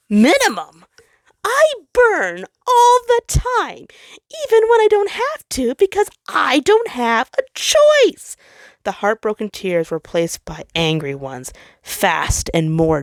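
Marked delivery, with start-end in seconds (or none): 0.1-8.4 furious hurt. Acted. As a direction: let the hurt boil over into anger.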